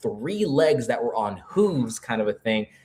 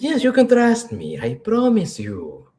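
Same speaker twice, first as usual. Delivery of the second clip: high, over-eager sales pitch voice; a lightly voiced sleazy dealer promising trust; shady salesman; 12 semitones higher, 4 dB louder, slower than usual. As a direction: oversell your honesty.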